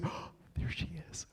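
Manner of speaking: breathless whisper, no voice at all; whispered aside. Retold or imitated. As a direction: hush it like a secret sighting. Retold surprise spotting old friend.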